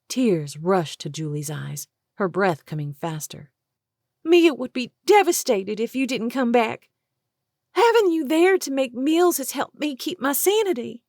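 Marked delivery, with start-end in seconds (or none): 4.2-11.0 high tearful pleading. Acted. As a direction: beg her not to leave. Julie pleading, breath faster.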